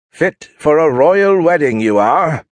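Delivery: high, loud mock British; bad British accent. Husband teasing his wife about her hat, bad British accent. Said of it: "failing miserably at matching his wife's natural British accent".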